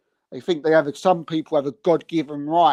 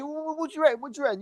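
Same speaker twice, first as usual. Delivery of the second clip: mock inquisitive fan; 10 semitones higher, 7 dB quieter, much faster than usual. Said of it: "and you say".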